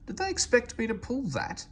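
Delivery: high, affronted question; outraged at the very idea; indignant. Acted. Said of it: "she asked indignantly".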